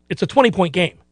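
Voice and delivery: ordinary talk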